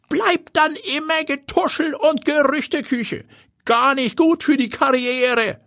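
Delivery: high, put-on old-man voice; advising old grandpa. A narrator's reading. Grandpa warning Timo off his granddaughter, high old-man voice. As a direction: counsel the lad as an old grandpa, pitched far up, slowing on key words.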